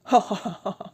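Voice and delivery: put-on drunken laugh. A narrator's reading. Laughing at his story.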